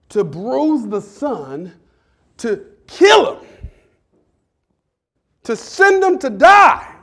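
slow, then rising to a loud high cry; solemn preaching. Acted. Pastor preaching the cross, slow then crying out.

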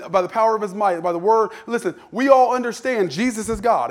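normal talking voice

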